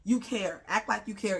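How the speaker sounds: normal talking voice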